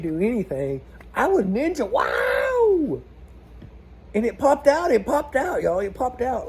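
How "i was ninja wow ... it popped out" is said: higher and louder, real whoop of triumph; excited shout. Real, not acted. To viewers, the stuck hose finally pops out: excited shout. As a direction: shout it like you just won.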